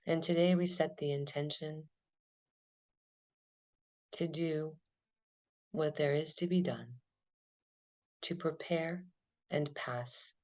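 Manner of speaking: calm, unhurried meditation guide; guided meditation. Acted. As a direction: lead them gently through the breath. Guided body-scan meditation.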